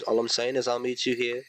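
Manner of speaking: usual speaking voice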